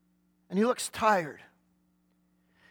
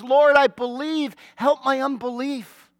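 earnest cry; 4 semitones higher, 6 dB louder than usual. Acted. Father cries out to Jesus.

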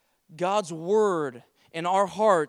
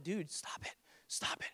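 hushed, far softer than usual; inner voice; 3 semitones lower, 16 dB quieter than usual. Retold or imitated. Inner voice chiding the over-preaching speaker, hushed. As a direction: imitate a nagging voice in your head, under the breath. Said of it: "hearing this voice like".

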